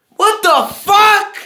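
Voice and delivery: high, loud, stretched-out shriek of disbelief. Real, not acted. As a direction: screech it like you can't believe the game. Raging at a confusing game.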